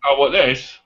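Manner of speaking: ordinary delivery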